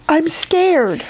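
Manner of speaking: high, lightly tearful voice; mild sob. A narrator's reading. Edith admits her fear to Little Bear, mildly sobbing. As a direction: confess it with a touch of a sob. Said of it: "Edith sobbed".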